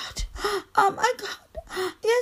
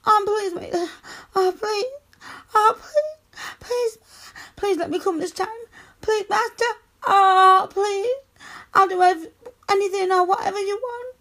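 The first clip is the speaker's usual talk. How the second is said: urgent pleading; 3 semitones higher than usual. Acted. Woman begging master for permission, desperate. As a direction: beg breathlessly, voice rising.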